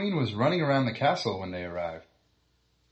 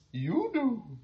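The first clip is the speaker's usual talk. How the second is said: high, firm queen; clipped high royal answer; 11 semitones higher than usual. Acted. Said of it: "said the queen".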